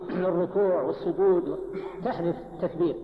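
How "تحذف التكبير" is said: normal talking voice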